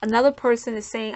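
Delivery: ordinary talk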